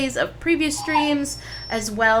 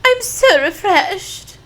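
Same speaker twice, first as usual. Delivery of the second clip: mock refreshed; 10 semitones higher, 7 dB louder, slower than usual. Game character voice after sleeping.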